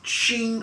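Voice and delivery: high, quick metallic ching; ringing sword-break noise. Retold or imitated. Game master voices the snapping sword, metallic ring. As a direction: imitate a blade shearing off. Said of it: "the sword just shears off".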